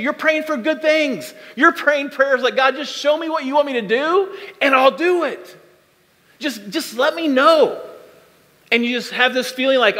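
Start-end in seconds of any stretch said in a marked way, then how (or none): 2.6-7.8 higher-pitched, imploring prayer, slowing at the end; implore, stretching out the last request; earnest plea. Acted. Believer begging God for direction, earnestly pleading.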